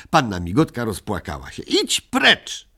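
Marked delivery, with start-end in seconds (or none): 1.6-2.7 high threatening yell, much higher; a child's high mock-menacing cry; menacing shout. A narrator's reading. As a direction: shout him away with a threat. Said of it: "krzyknął groźnie Migotek".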